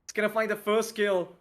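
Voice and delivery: high, urgent caster call; excited play-by-play. Real, not acted. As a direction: surge up as the attack lands. Live play-by-play of an attack.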